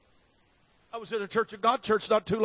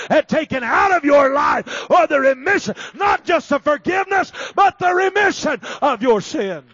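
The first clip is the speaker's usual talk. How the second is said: loud, raised preacher's delivery; full-force preaching; 4 semitones higher, 9 dB louder than usual. Real, not acted. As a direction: build to a shouted, urgent appeal.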